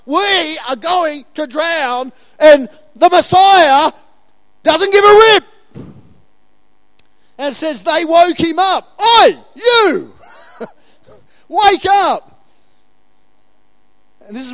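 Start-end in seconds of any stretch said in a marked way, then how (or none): 0.1-5.4 slow, loud, higher panicked cry; panicked disciples. Acted. Pastor voicing disciples in the storm as a panicked, loud cry. 9.0-12.5 loud, high shout to wake a sleeper; frantic shout. Acted. Pastor voicing disciples shouting to wake Jesus, frantic yell.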